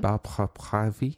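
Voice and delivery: French accent that slips Italian; French accent, encore. Shown as a demonstration. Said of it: "Oh, do it again, [name]".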